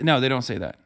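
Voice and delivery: ordinary talk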